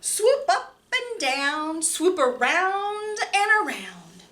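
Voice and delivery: lively picture-book reading with big pitch swings; reading to children. Acted. Librarian reading Hooray for Birds to children, lively and playful. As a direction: read it bouncy and playful for small kids.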